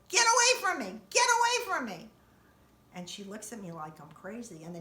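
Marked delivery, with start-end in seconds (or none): 0.1-2.1 high, loud panicked yell; frantic scream. Retold or imitated. Yelling at the mail lady to stay back, panicked scream. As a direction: shriek her away from the mailbox. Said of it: "I start screaming".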